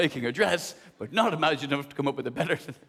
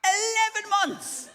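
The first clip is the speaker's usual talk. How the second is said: incredulous; 12 semitones higher than usual. Real, not acted. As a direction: stretch it out in disbelief. Outraged echo of the news.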